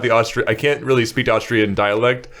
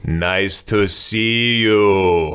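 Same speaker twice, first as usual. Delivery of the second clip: put-on very slow, effortful Austrian-accented English; drunk Austrian pushing out English; 2 semitones lower, much slower than usual. Retelling the drunk villager's farewell in very slow Austrian-accented English. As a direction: drag each word out like a drunk Austrian with little English. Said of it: "he just pushes out this English".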